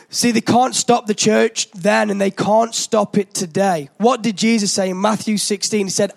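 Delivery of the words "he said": ordinary talk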